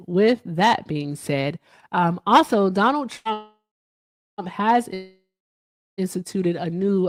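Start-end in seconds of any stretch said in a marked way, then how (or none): 0.1-1.6 slow emphatic repeat. Real, not acted. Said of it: "repeated slowly".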